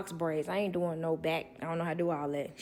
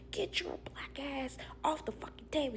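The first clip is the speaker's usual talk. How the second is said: hissed retold curse; 7 semitones higher, 7 dB quieter than usual. Old man's cursing, whispered.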